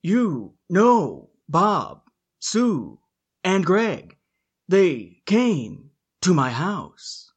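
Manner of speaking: halting, very slow dramatic pauses between words; Shatner impression. Host doing Shatner for Mark, slow halting delivery. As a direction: ham it up like William Shatner, breaking every phrase. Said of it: "Or the Shatner comma".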